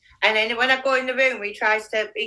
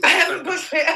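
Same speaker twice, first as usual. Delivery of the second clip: laughing; 4 semitones higher than usual. Real, not acted. Laughing at forgetting to brush hair.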